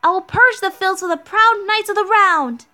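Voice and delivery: high, proud heroic proclamation; Mashu, high voice. Acted. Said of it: "I can't speak so high".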